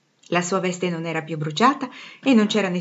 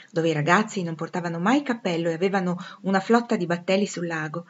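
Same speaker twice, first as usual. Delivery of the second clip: plain reading voice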